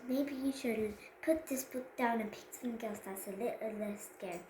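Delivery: ordinary delivery, nothing marked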